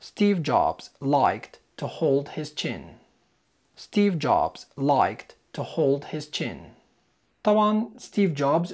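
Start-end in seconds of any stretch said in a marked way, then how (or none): 0.1-2.8 slow, careful reading of the example; slow model sentence. Shown as a demonstration. Teacher reading the example slowly. 3.8-6.6 slow, careful reading of the example; slow model sentence. Shown as a demonstration. Teacher reading the example slowly.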